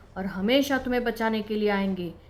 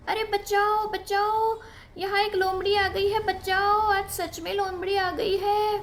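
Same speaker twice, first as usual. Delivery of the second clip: mock cry for help; 9 semitones higher than usual. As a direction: shout for rescue as a prank. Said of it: "जोर-जोर से चिल्लाने लगा". (ऊँची नकली पुकार)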